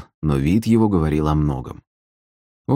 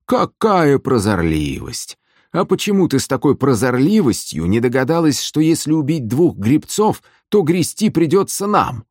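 high, exasperated sarcasm; feigned amazement; 9 semitones higher than usual. A narrator's reading. Gusli player scolding the sister over rowing, sarcastic amazement. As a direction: marvel mockingly, pitch climbing as you go. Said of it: "наигранно удивился Вадзим".

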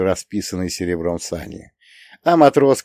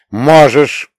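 sharp, loud cut-in; sharp interruption; 5 semitones higher, 9 dB louder than usual. A narrator's reading. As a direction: cut her off sharply, rudely. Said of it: "Резко и непочтительно перебил я её".

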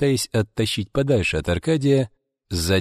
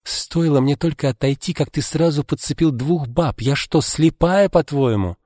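higher, accusing female voice; a jealous wife accuses her husband, higher and sharp; jealous outburst; 6 semitones higher than usual. A narrator's reading. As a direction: hurl the accusation at him.

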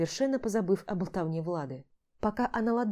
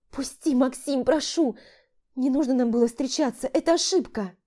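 put-on pleading young woman; 6 semitones higher, 5 dB louder than usual. Dina pleading to be released.

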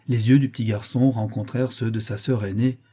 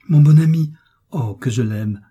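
joyful outburst, loud and high, then easing; gleeful child's exclamation; overjoyed; 5 semitones higher, 8 dB louder than usual. A narrator's reading. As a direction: burst out with delight, then soften. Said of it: "dans l'excès de sa joie".

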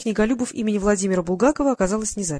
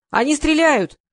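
high, loud call from the shelves; raised voice, pitched up; 7 semitones higher, 5 dB louder, slower than usual. A narrator's reading. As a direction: shout it across the shop. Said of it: "крикнула из стеллажей Марина".

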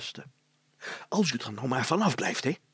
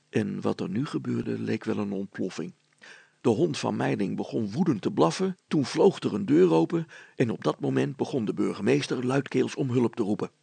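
plain reading voice

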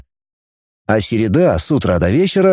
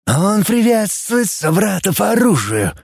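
alien translator voice; 7 semitones higher than usual. Acted.

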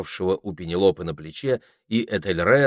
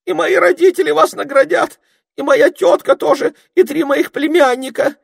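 frantic begging; 11 dB louder than usual. A narrator's reading. Ermine keeps begging.

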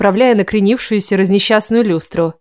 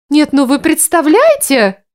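indignant; 6 semitones higher, slower than usual. A narrator's reading. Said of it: "громогласно возмущалась Дарла".

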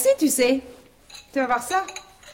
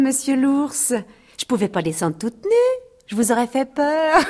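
normal talking voice

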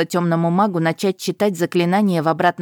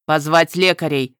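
shouted order; 4 dB louder than usual. A narrator's reading. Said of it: "крикнул один из них".

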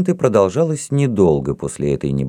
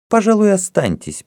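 careless permission; 13 semitones higher than usual. A narrator's reading. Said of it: "с небрежным движением руки".

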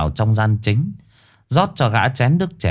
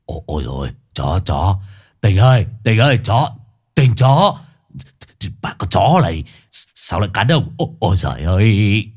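panicked yelping. Acted. Long yelps at the biting dog.

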